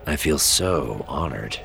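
slow, dry sarcasm; understated, unhurried sarcastic reply. A narrator's reading. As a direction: drag it out in mild sarcasm. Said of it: "Jag said sarcastically".

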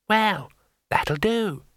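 a small painted imp, unhurried; tiny imp. Acted. Imp settling the matter for the wizard, small creature voice.

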